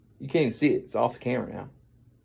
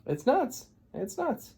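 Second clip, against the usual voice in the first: high, small-voiced amazement; squeaky disbelief; 12 semitones higher, 4 dB quieter, slower than usual. Storyteller marvelling at the long nose, squeaky disbelief.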